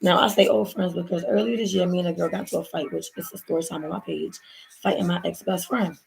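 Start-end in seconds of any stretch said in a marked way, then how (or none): none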